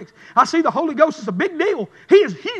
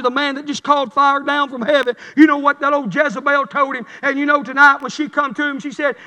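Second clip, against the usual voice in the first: usual speaking voice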